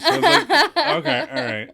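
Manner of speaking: high, giggling disbelief; laughing. Real, not acted. Remembering quitting local news, laughing.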